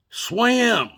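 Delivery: high, loud imitation of an aunt's order; shouted command. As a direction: holler it out to a child in the water. Aunt calls out to struggling child.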